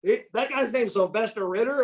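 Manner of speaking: high-pitched incredulous question; amused disbelief. Retold or imitated. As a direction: repeat the name as if you can't believe it.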